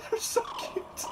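high breathless whisper over cuteness; whispered cooing. Real, not acted. Player gushing over baby creatures, high whisper. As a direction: breathe it out, overwhelmed by cute.

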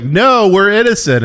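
high, loud mock plea; drawn-out cry of innocence from a pretend victim; acted plea. Acted plea of mall crowd.

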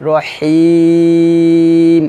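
রহিম with the ই stretched very long, three alif; long drawn vowel. Shown as a demonstration. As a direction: hold the ই of রহিম very long, stop on ম. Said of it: "আমি যদি টেনে পড়ি তাহলে হবে". (তিন আলিফ টেনে রহিম)

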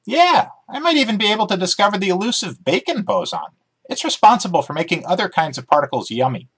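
chirpy dog character, keen to explain; eager talking dog. Acted. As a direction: bounce through it like an excited dog. Dog Emmy voiced in story.

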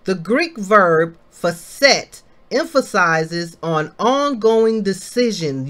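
ordinary talk

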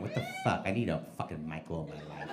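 a hint of swooning women; low mock swoon; mock Mexican chicks. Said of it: "Mexican chicks going".

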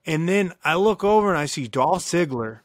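usual speaking voice

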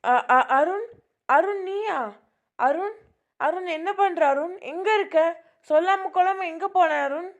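mother suddenly hearing her missing son, high and urgent; frantic relief. Acted. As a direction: cry out to the lost son on the phone. Mother hears missing son on phone.

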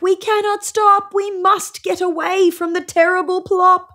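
high, frightened rabbit voices; rabbits crying back. A narrator's reading. Rabbits crying back to the fox, high and scared. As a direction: cry it back in a panic. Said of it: "But the rabbits cry back".

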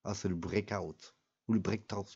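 hammed-up English with a Canadian twist, breakout stretched slowly; mock Canadian English accent. Gamer showing viewers his magnifique English accent, mock Canadian accent. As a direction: show off a playful Canadian-ish English accent. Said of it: "Vous avez vu, mon accent, il est magnifique".

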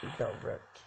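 soft whisper; whispered gloat; whispered taunt. Real, not acted. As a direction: breathe the jab.

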